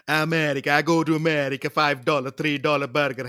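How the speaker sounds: loud mock American accent. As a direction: put on a hammy Yankee accent. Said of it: "That's the American accent".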